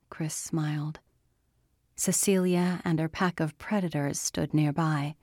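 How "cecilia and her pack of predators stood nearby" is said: plain reading voice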